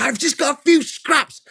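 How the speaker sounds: high, strained furious rant; ranting at God. Retold or imitated. To God at dawn while feeding the dog, high furious rant. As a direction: vent the anger at God, voice up high. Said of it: "I'm like".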